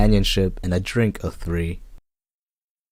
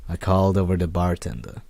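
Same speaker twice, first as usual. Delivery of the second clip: normal talking voice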